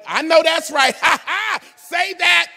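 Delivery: high, loud laughing shout; excited shout. Real, not acted. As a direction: whoop it up with the crowd. Preacher cheering with crowd.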